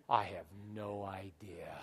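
low, slow near-whisper; hushed admission. Retold or imitated. Pastor stumped by a name, low hushed admission. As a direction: drop to a slow, helpless whisper. Deadpan answer to the mall greeters.

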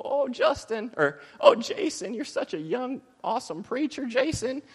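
high-pitched gushing church lady. Retold or imitated. Church lady praising the young preacher, high and gushing. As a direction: coo it like an admiring older lady. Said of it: "some lady will come up to me and go".

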